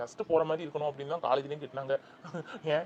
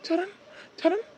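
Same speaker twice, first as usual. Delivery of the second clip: frantic calling; 7 semitones higher than usual. Acted. Father hears his child crying.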